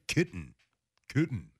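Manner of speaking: kitten with a dark "kuh" and swallowed T; mock "kitten" as "kut'n"; imitating co-host. Host imitating co-host, swallowed kut'n. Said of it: "make it my deep voice".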